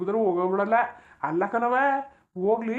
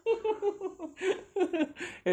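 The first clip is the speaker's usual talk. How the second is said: mocking laugh; 10 semitones higher, 5 dB quieter than usual. Mocking their quarrel.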